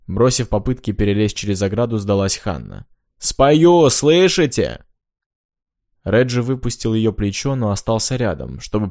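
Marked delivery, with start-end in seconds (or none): 3.2-4.8 loud, high, drawn-out call. Acted. Hanna gives in and calls to the houseboat, loud and high. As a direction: holler it across so they hear.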